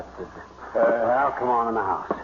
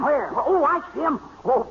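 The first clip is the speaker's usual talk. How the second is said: excited; 14 semitones higher than usual. Acted. Spots the ducks.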